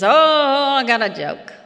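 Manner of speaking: a hint of an eager old storyteller; imitating storyteller dad. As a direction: lightly play a dad eager to tell a joke. Imitating her storyteller father's announcement.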